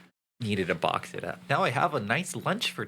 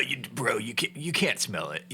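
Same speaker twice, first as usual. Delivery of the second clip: normal talking voice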